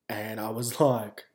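ordinary talk, nothing marked

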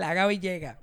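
usual speaking voice

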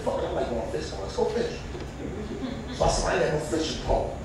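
a hint of a mumbling younger self; lightly voiced younger self. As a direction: mutter it like a broke guy shrugging. Voices himself to a buddy.

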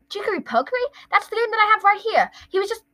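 a hint of an excited mother; excited mom.

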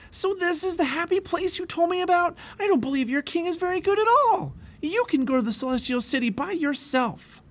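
very high, angry outburst; screaming. A narrator's reading. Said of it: "Pliable screamed".

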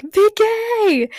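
high, loud mock yell of the name; imitating the yell. A fan imitating Jeremy's yell at Vicky. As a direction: call the name out like the actor. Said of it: "He's like".

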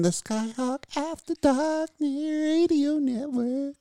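slow, drawn-out radio announcer; improvised station jingle. Acted. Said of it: "make that shit up on the fly".

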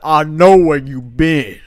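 loud, drawn-out mock threat; mock menace. Friend voicing the staring strangers, loud mock menace. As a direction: boom it out slowly as a teasing taunt.

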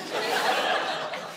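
quiet airy chuckle; soft breathy laugh. Real, not acted. Pastor laughing at the butt-dial joke, soft airy chuckle. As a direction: laugh it off under your breath.

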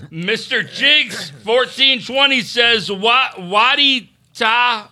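slow, high, drawn-out shout-out; hype announcer read. Acted. Reads super chat in hype voice.

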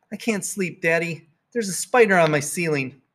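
put-on high, small child pleading; a scared kid tugging at dad; little girl voice. Girl waking her dad, scared of a spider.

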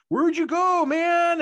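high, loud retold yell; high-pitched shout, acting it out; calling out. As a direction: call after someone who vanished. Retold calling after vanished brother.

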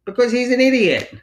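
high, loud exasperated jab; emphatic put-down. Real, not acted. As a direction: blurt it out. Emphatic put-down of Tommy Lee.

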